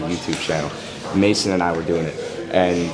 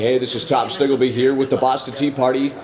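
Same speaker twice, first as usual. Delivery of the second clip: higher-pitched announcer impression; Bob Menery; 4 semitones higher than usual. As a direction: put on a sportscaster's voice, pitched up from your own. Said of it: "an impression of his voice".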